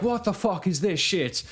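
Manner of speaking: high, put-on Irish accent; comic Irish-accented rant, pitched high; mad Irish raccoon. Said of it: "I'm not sure why I made the raccoons Irish".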